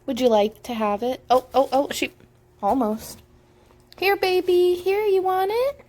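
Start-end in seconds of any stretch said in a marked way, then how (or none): 4.0-5.9 high coaxing coo. Real, not acted. Offers baby something.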